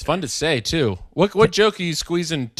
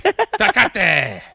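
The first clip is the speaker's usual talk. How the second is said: hammed-up Mexican accent; 5 semitones higher than usual. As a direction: holler Tecate in an over-the-top Mexican accent. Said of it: "like, the accent of it".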